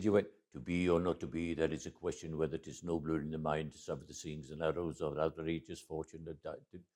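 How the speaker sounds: lightly voiced rushed Hamlet recitation; a hint of a stage actor racing through Hamlet; Richard Burton impression. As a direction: rattle off Shakespeare as a hurried actor. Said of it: "I did that so you'd hear my impersonation".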